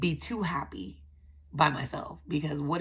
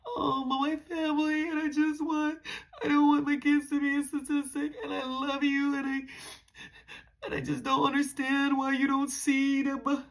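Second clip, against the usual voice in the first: high, tearful, breaking plea; groveling plea; 8 semitones higher, 6 dB louder than usual. Retold or imitated. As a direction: beg through tears like your family depends on it. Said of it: "I was groveling".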